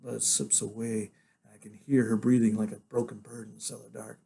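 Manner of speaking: ordinary delivery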